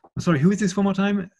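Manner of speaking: incredulous. Retold or imitated. Asks the caller again, incredulous.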